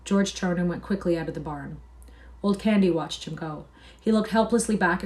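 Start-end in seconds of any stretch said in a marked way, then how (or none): none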